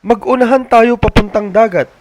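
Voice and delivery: put-on high, loud eager child's shout; excited race call. David challenging siblings to race, high excited shout. Kids racing to the sea.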